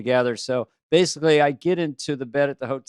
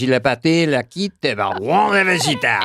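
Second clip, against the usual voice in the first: mock French gibberish; hammed-up French nonsense; fake French; 5 dB louder than usual. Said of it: "fake French".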